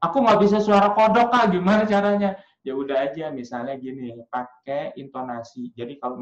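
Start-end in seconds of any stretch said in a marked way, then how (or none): 0.0-2.3 high, loud, pleading questioner; raised, insistent voice of someone asking for help; imagined questioner. Acted. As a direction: call out a worried question to a teacher. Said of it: "misalnya ada yang nanya".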